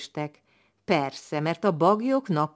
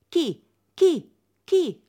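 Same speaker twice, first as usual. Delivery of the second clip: high, sharp calls rising louder; chasing shouts; 13 semitones higher, 5 dB louder, much slower than usual. Acted. Wedding guests chasing the dog out, high sharp shouts. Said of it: "Kergették, és mindjárt".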